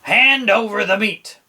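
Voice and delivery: high, loud demand; hammed-up pirate demand; greedy pirate. A narrator's reading. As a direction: demand the food like a hungry captain. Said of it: "gulped his food and said".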